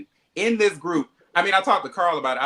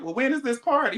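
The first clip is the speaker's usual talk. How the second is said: pitched way up in disbelief; high incredulous question; 10 semitones higher than usual. Retold or imitated. To a friend about the surprise party, a high disbelieving squeak. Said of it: "I said".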